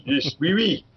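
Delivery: put-on playful French accent, high; hammed-up French accent "oui, oui". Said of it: "you gotta do a French accent".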